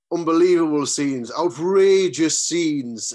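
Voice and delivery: put-on drunken Irish accent, loud and high, stretched slowly; thick Irish accent. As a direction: do a half-cut Irish footballer, drawn out and loud. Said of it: "in this amazing thick Irish accent and just really half cut".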